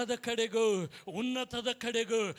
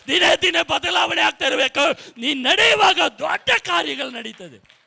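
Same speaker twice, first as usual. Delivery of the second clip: loud, building preacher's cry, higher by the end; preaching at full force; 4 semitones higher, 9 dB louder than usual. Acted. Pastor rousing the congregation, shouted preaching. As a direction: proclaim it to the whole hall, rising.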